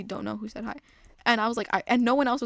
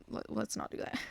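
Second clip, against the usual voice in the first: whispering; 10 dB quieter than usual. Real, not acted. Aside to viewers.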